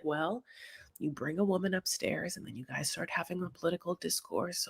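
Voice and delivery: very soft, hushed imitation; mock careful explanation. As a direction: murmur it like a cautious excuse. Imitates guest's careful evasive answer.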